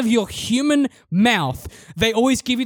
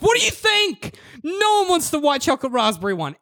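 higher, incredulous mock outburst; a mock-indignant raised retort; exasperated retort; 6 semitones higher than usual. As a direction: scoff at the obvious question. Exasperated reply to server.

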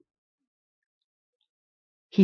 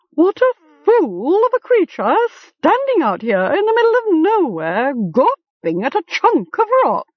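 high, screechy scolding raven voice; a cantankerous bird mocking a fool; screeching raven; 11 semitones higher than usual. A narrator's reading. Raven screeching at Gom on the cliff. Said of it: "it screeched".

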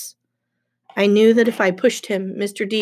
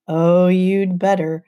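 slow, drawn-out warning; murmured warning; 4 dB louder, slower than usual. Acted. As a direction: draw it out as a veiled threat. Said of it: "Mister D murmured".

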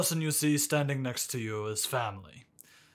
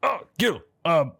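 operator voice; 4 semitones higher than usual. Pretend. Operator voice on the communicator.

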